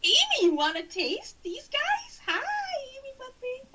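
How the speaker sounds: high, cooing dog-talk voice. Real, not acted. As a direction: coo at the dog. Talking to the dog.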